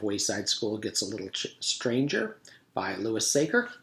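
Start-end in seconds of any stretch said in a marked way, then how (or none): none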